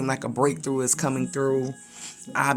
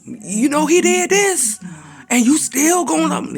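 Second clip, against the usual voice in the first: very high, loud, incredulous voice; Job's wife scolding; 13 semitones higher, 7 dB louder than usual. Retold or imitated. Wife mocking Job for still loving God, high and loud. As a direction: challenge him in disbelief. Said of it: "talking the side of like".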